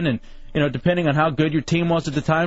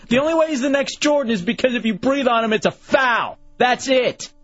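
sports rant; 7 semitones higher than usual. Real, not acted. Ranting about Dwayne Wade's fouls.

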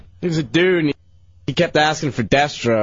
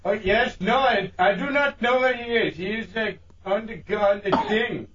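higher fake voice, hiding your own; disguised voice; 5 semitones higher, 4 dB quieter than usual. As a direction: play a stranger on the phone in a put-on voice. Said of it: "just to use that voice".

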